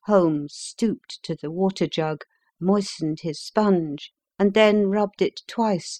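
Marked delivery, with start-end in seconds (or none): none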